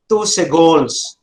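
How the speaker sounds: normal talking voice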